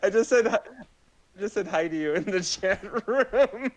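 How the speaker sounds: high, giggly voice cracking up; helpless giggles breaking into the words; laughing. Real, not acted. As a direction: struggle to speak through laughter. Cracking up mid-sentence.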